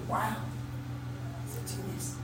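whispered, mock awe. Teacher mocking the self-made innovator, whispered. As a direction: breathe it like a secret.

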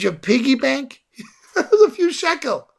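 high-pitched, incredulous laughing voice; imitated laughing. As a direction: act a sister cracking up in disbelief. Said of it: "the sister burst out laughing".

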